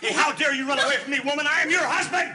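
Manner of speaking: furious shout. Acted. Husband yelling at his apologising wife, furious shout. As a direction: let the rage burst out.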